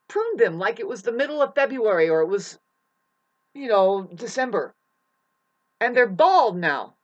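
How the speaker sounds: loud, indignant complaint rising in force; outraged rant. Real, not acted. Outraged over neighbor's pruned trees.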